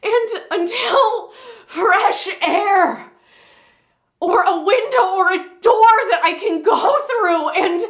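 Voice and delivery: high-pitched, anguished outburst; desperate monologue. Acted. Woman carrying too much for so many people, desperate. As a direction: plead at breaking point, voice far above normal. Performs a monologue at breaking point.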